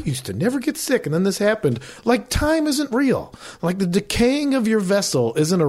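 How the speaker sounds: high, sarcastic mock of naive people. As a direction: sneer at people shocked by aging. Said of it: "These people are like".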